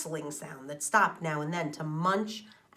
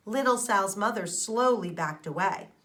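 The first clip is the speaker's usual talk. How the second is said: ordinary delivery, nothing marked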